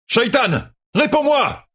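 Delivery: high, ringing yell; shout. A narrator's reading. Rand calls the dark one to answer, shouting. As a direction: yell it upward, demanding an answer. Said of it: "cria-t-il".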